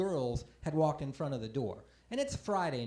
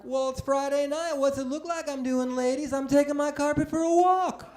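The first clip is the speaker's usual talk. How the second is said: cocky retort; 7 semitones higher, 4 dB louder than usual. Retold or imitated. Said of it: "so I'm like".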